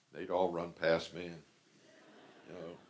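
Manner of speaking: usual speaking voice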